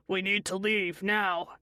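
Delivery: ordinary delivery, nothing marked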